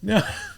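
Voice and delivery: high sudden laugh; burst of laughter. Acted. Raskolnikov laughing at the baffled policeman, sudden high laugh. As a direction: laugh it out wildly. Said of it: "Raskolnikov burst out laughing".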